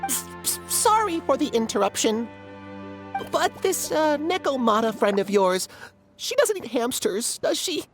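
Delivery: nervous, stammering little creature; timid hamster. Acted. Hamster asks if newcomer eats hamsters, timid. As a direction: start hushed, stammer an apology, then ask warily. Small creature fears being eaten.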